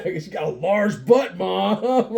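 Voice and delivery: loud, high teasing call; laughing, hammed-up teasing; playful banter. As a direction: holler it to rib mom. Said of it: "it's just playful banter".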